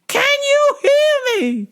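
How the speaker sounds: put-on very high, slow shouting old lady; old lady caller. Old caller yelling can you hear me, high and loud. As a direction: shout slowly into the phone.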